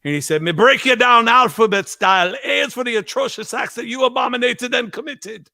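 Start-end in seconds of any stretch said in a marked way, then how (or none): 0.4-2.3 high-pitched mock Jamaican rant; imitated Jamaican dad. Drunk Jamaican dad scolding at four a.m., imitated rant. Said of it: "his dad one day got really drunk". 2.4-5.5 higher-pitched mock Jamaican rant; imitated Jamaican dad. Drunk Jamaican dad scolding by the alphabet, imitated rant. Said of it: "If I try to do Jamaican".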